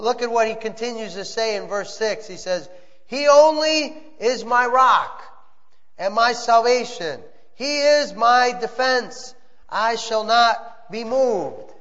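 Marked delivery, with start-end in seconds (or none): 3.1-11.7 slow, raised proclamation; slow psalm proclamation; scripture reading. Acted. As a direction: declare each line slowly. Reads psalm verse six aloud.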